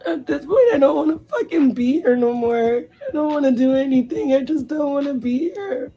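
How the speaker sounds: high, tearful wail of despair; crying. Real, not acted. Grieving friend with nothing left to do, crying. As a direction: plead helplessly through real sobs. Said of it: "Been crying".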